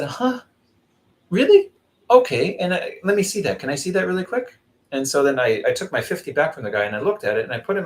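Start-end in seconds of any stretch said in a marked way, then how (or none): 0.0-4.6 high, exaggerated feigned disbelief; mock surprise. To the beggar demanding more, feigned high astonishment. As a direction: act astonished to get the money back. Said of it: "I said".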